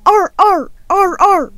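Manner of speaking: loud playful barking; dog barks. Pretend. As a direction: bark like an eager puppy. Pretending to be a dog.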